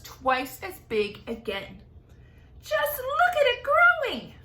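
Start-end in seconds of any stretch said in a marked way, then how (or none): 2.6-4.3 high, loud aunt's cry of glee; excited high cry. Acted. Aunt crying out at the growing peach, excited. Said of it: "Aunt Spiker cried".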